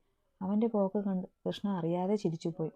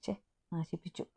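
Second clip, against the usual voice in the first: soft, deflated grumble; disappointed; 5 dB quieter than usual. A narrator's reading. Joel sulking after Daisy interrupts, soft disappointed mutter. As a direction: let the air out, moment spoiled. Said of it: "Joel നിരാശയോടെ പറഞ്ഞു".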